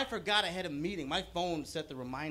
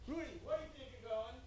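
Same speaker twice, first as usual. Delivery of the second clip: high-pitched mock yell, kept soft; thin-volume imitation of an angry call; retold yell; 9 semitones higher, 8 dB quieter than usual. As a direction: imitate someone calling after you, high but quiet. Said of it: "and she yells out".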